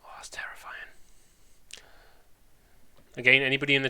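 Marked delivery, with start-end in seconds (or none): 0.0-0.7 whisper. Pretend. Mock fear at second year.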